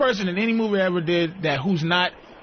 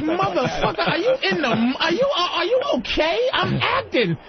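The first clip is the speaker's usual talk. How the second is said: high, incredulous mock outrage; hammed-up indignant retort, pitched well up; indignant actor; 11 semitones higher than usual. Method actor scolding whoever broke character, mock indignant.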